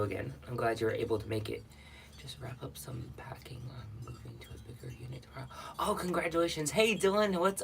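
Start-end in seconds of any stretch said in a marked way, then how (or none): 2.2-5.5 very soft, half-whispered reading; hushed read-out. Real, not acted. Streamer reading a viewer's moving news to herself, hushed. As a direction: read it under your breath.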